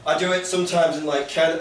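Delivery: ordinary talk, nothing marked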